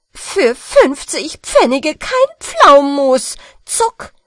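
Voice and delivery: loud angry scolding; angry shout. Acted. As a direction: yell at the boy in fury. Angry quarrel of villagers.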